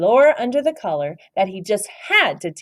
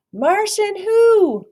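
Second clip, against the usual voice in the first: high, slow, drawn-out who; puzzled question; 14 semitones higher, 6 dB louder, much slower than usual. Acted. Astronaut asks the voice who it is, puzzled. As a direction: stretch the question out, baffled. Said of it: "knock-knock joke reply".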